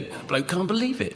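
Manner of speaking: ordinary talk, nothing marked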